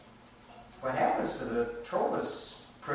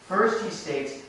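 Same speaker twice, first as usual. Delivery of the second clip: usual speaking voice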